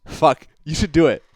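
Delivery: laughing loudly through the words. Real, not acted. Streamer laughing at a chat joke, loud real laughter. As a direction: crack up and egg them on.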